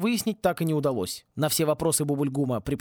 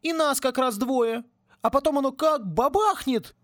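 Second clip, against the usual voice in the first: much higher whiny plea; whining; 10 semitones higher than usual. Acted. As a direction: whimper it like a caught kid. Twins whine excuses. (скулит, как пойманный мальчишка)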